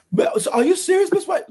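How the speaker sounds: imitating Susan. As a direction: play the stunned employee on the phone. Employee Susan voiced in story.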